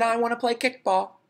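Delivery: put-on 1 high kid voice. Ron voiced again.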